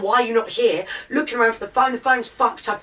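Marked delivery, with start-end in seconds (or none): none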